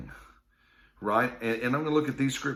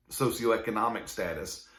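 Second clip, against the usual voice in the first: usual speaking voice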